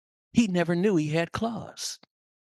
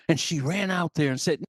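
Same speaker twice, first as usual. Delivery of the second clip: normal talking voice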